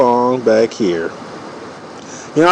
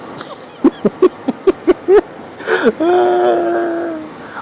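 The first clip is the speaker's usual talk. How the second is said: howling laugh. Real, not acted. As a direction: burst out in a wild laughing shriek. Howling laugh at own joke.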